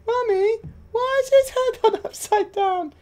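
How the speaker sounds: put-on high squeaky little kid voice; child voice. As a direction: pipe it up like a small child. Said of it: "as children regularly tell me".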